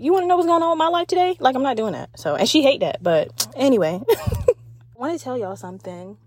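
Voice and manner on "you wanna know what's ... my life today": mock chatty oversharer.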